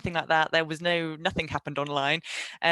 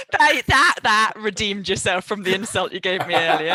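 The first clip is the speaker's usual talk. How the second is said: laughing; 2 semitones higher, 6 dB louder than usual. Real, not acted. Laughing reply to compliment.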